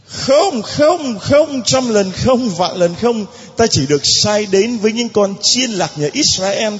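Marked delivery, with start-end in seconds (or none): 0.1-3.3 high, insistent no after no, slowing down; emphatic refusal. Acted. Jesus refusing the pleading woman, emphatic high refusal. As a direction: refuse flatly, each no pitched up.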